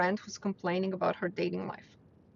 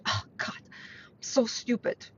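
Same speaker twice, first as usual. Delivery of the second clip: self-put-down; 5 dB quieter, slower than usual. Retold or imitated. Acting out self-deprecating self-talk.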